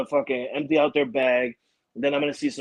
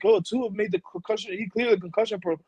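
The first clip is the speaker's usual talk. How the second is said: higher-pitched imitation of breaking news; lightly mocking news-flash read; 6 semitones higher than usual. Annoyed dad mimicking a breaking news report, mock urgency. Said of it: "make a breaking news like".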